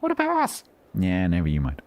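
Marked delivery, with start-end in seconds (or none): 0.0-0.6 very high, squeaky mock protest; imitating indignant YouTubers. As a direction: pipe up high as the left-out crowd. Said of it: "YouTube are like".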